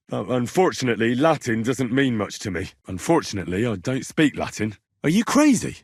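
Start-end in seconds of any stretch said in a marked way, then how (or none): 5.0-5.8 incredulous outburst. Acted.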